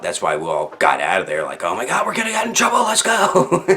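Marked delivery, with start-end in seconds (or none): 1.6-3.7 high, fast, frantic rush; panicked. Retold or imitated. Kids scared of getting caught, high frantic rush.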